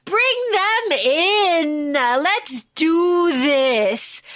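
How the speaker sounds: drawn-out, imperious summons; very slow, grand villainess delivery; relishing empress. Acted. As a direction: stretch every word slowly, savouring it. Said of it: "the empress is like".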